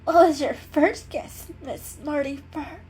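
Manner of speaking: high, loud, teary sarcasm from a sick kitten; a sick cat snapping back through tears; sick, sarcastic kitten. A narrator's reading.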